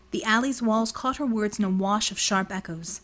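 ordinary delivery

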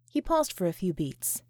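usual speaking voice

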